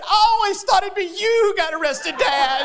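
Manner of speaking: high-pitched imitation of a child; little daughter. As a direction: mimic a young daughter's retort. Little daughter's retort acted out.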